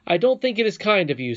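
loud, high, wounded reproach; hurt protest. A narrator's reading. Curdie accuses the princess of mocking him, loudly hurt. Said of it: "feeling very much hurt".

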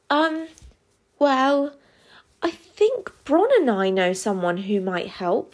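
hesitant, with halting pauses; nervous. Acted. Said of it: "said Cam nervously".

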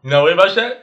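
loud, high mocking question; teasing disbelief. Real, not acted. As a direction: needle him with disbelief.

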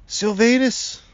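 loud, high-pitched call; excited yell. A narrator's reading. Father spotting the tree man waking, excited yell. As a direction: holler the name in delight. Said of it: "Lucas yelled".

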